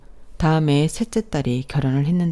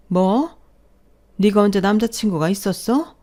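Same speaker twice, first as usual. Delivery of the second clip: incredulous outburst, pitched up; shocked parent; 5 semitones higher, 4 dB louder than usual. Acted. Parent to daughter who just confessed pregnancy, shocked. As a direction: demand an answer in raised disbelief.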